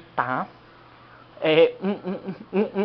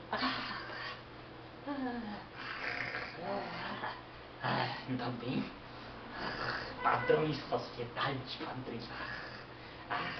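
quiet satisfied sighs; 3 semitones lower, 11 dB quieter, much slower than usual. Acted. Man pleased, quiet and low.

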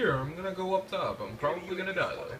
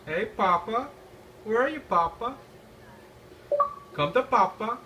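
high teasing calls, louder; taunting sing-song; 8 semitones higher, 4 dB louder than usual. Pretend. Gamer calling out to an enemy in a high mocking sing-song. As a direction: lure him in mockingly.

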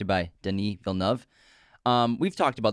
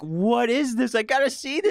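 excited; 12 semitones higher than usual. Retold or imitated. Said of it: "I was like".

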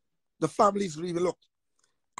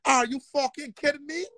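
ordinary talk, nothing marked

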